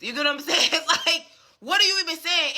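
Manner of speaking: laughing through the rant. Real, not acted. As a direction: laugh while you ask. Laughs while ranting.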